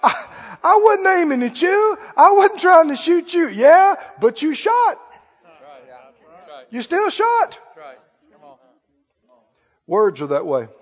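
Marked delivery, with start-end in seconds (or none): 0.0-3.5 higher, pleading sorry; sheepish pleading, pitched up; mock apology. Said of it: "And I said". 3.5-7.6 higher, slower hurt reply; the wounded one answering back; reproachful, pitched up; mock reproach. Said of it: "People are going".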